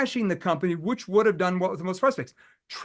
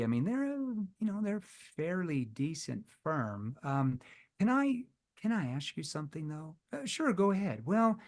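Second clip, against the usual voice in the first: soft, empathetic, hesitant voice, much softer; concerned tone; 4 dB quieter than usual. Shown as a demonstration. Salesman gently voicing doubt to a prospect, concerned and soft. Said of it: "what type of tone did I just use there? A concerned tone".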